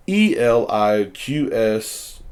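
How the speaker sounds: "E L I Q S" read slowly as separate letters; spelled out slowly. Shown as a demonstration. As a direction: spell "E L I Q S" out one letter at a time. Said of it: "how did you say that?".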